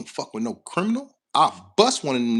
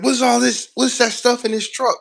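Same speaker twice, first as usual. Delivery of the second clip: imitating a suspicious Russian coworker, higher; mock-alarmed questions in a raised voice; voicing the Russian crew's shock at the truck; Russian coworker quoted; 7 semitones higher, 4 dB louder than usual. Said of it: "He was like".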